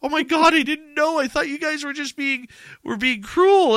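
high, loud shocked mother; mom's surprise. Retold or imitated. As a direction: gasp it out high as the startled mom. Mom discovering misunderstanding.

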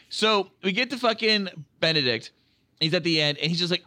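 ordinary delivery

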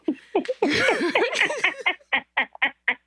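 long high laugh. Real, not acted. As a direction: burst out laughing at the story. Laughing at own story.

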